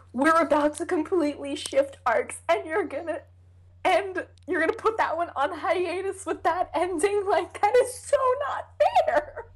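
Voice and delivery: indignant, climbing to a very high squeal; high-voiced anger. Real, not acted. Fan protesting the hiatus ending, high-voiced anger. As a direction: let outrage push the voice up and up. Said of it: "I get really high-voiced when I'm angry".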